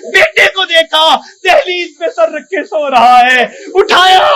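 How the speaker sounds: preacher voicing an angry mother through tears; dramatic preaching. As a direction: tell it with mounting anguish. Emotional story preaching.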